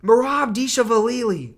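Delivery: Dishvalili drawn out slowly as a garbled Merab surname; mock mispronunciation. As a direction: mangle the name Dishvalili, high and slow. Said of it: "pronouncing the fighters' names wrong".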